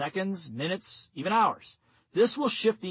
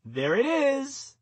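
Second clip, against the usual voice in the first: slow, high "There it is" through a smile; leisurely high "There it is", savoured with a smile; smiling, drawn out; 8 semitones higher, much slower than usual. Shown as a demonstration. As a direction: stretch "There it is" out slowly, high and pleased. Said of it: "smile again and say".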